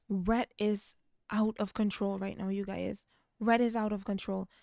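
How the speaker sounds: normal talking voice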